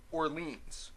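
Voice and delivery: Orleans ending in a long "leens". Shown as a demonstration. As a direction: say Orleans with an ee vowel. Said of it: "that's how I'm gonna pronounce it".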